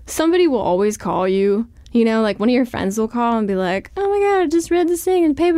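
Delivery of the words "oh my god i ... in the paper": much higher, fast, excited gush; breathless friend with news; gossipy friend. Retold or imitated. A friend calling after reading the paper, gushing.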